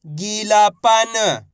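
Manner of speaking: गीलापन very slow, every syllable held; syllables blended slowly. Shown as a demonstration. Teacher joining letters for learners, गीलापन drawn out. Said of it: "तो मिलके बन जाएगा".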